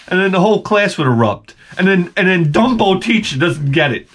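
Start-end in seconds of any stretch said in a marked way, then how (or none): none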